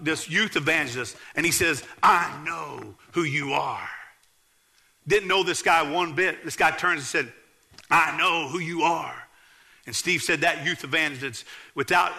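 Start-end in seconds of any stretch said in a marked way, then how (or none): 2.0-3.9 low growly voice. Retold or imitated. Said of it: "a low, growly voice, a firm voice". 7.9-9.3 slow, firm menacing stranger; a hint of a growly threat; low growly voice. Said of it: "a low, growly voice, a firm voice".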